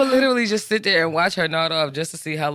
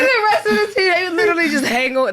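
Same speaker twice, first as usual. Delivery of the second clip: laughing; 11 semitones higher, 4 dB louder, faster than usual. Real, not acted. Laughing while telling story.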